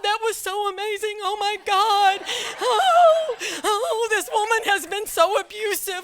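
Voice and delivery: high, loud gushing celebration; overjoyed high-pitched relief; excited colleagues. Retold or imitated. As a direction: gush like cheering coworkers. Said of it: "celebrating, screaming, yelling".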